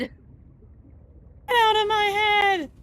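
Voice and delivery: high, slow, drawn-out anguished cry; Akira movie quote. Retold or imitated. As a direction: wail it like the Akira scene. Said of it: "Like that fucking Akira scene".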